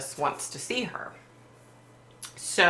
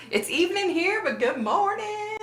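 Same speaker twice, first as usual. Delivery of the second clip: sing-song greeting. Real, not acted. Greeting a viewer in chat.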